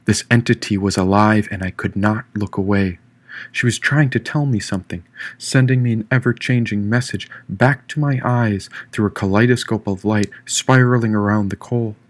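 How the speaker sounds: ordinary delivery, nothing marked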